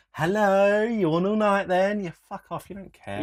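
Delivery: high, loud, slow sarcastic jibe; mocking impression of the rude queue guy; mocking the busybody. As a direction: ham up the nosy man's scolding. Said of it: "that dickhead's the type of guy going".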